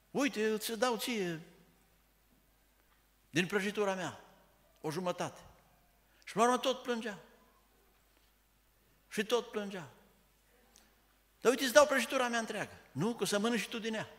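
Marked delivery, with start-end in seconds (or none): 0.1-5.4 coaxing guest. Acted. Guest offering half his cake to a crying child, lightly voiced and coaxing. 11.4-14.1 a lightly voiced guest, higher, louder and fast, urging; pleading guest. Guest pushing his whole cake on the child, lightly voiced and fast.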